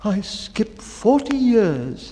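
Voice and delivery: high, slow, tearful plea; a mild tearful catch, slowed right down; in tears. Acted. Baker in tears hurrying his story, mildly tearful. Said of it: "said the baker in tears".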